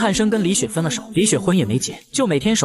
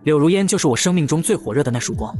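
plain reading voice

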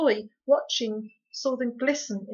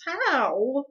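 strange hollow, louder; hollow disbelief; 6 semitones higher, 9 dB louder than usual. Acted. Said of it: "strange, hollow voice".

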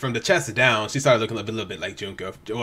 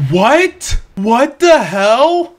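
high, very loud disbelief, drawn out; shocked outburst; 12 semitones higher, 10 dB louder, much slower than usual. Real, not acted. Viewer reacting to Zero's appearance, shocked. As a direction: cry out, stunned, stretching the words.

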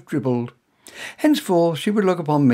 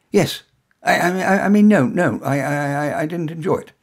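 flustered stammer; 4 semitones higher, 7 dB louder than usual. Acted. Tongue-tied hero stammers.

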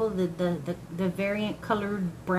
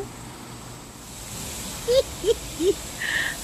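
giddy laugh; 11 semitones higher, 4 dB quieter than usual. Real, not acted. Laughs after harvest.

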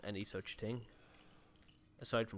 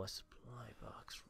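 whispering; 13 dB quieter, slower than usual. Real, not acted. Whispered discovery.

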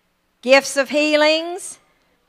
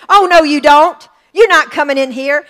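rebuking the storm; 3 semitones higher, 10 dB louder than usual. Retold or imitated. [name] teaching how to rebuke a storm, a very loud rebuke. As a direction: shout down the storm. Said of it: "you pound that earth and you say".

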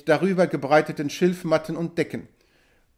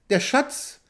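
"Der Schatz" asked with a sharp high rise; startled question; 7 semitones higher than usual. A narrator's reading. Chief stepping back from the hunter, startled, "Der Schatz?" rising high. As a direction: recoil at the word, pitch jumping up on "Schatz". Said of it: "fragte der Häuptling, indem er einen Schritt zurücktrat".

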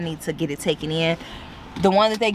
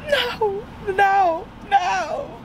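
laughing refusal; much slower than usual. Real, not acted. Rejecting the massage lady's request.

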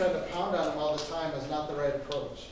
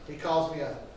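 ordinary talk, nothing marked

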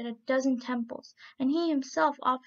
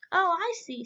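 higher, louder aha; dawning realisation; 6 semitones higher, 5 dB louder than usual. A narrator's reading. Hulin and gander understanding the riddle, surprised. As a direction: catch on with delight. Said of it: "said Hulin and Chang together".

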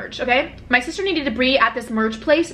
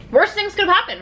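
exclaiming; 9 semitones higher than usual. Real, not acted. Exclaims then repeats.

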